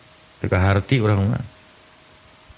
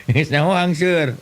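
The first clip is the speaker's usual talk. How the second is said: usual speaking voice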